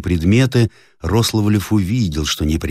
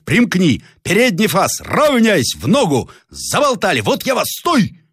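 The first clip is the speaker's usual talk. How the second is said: very high barked commands; shouting; 13 semitones higher than usual. Acted. Captain to the front rank, very high shouts. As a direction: bellow at the faltering front. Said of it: "закричал Зарядьев".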